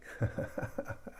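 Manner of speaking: short breathy chuckle, low in volume; soft chuckle. Real, not acted. Host greeting a viewer who joins, soft chuckle. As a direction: chuckle softly to yourself.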